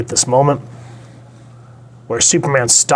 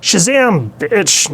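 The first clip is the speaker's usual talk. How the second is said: high, taunting villain reveal; mock gloating; 9 semitones higher, slower than usual. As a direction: crow it like the trick just worked. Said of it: "then here he goes".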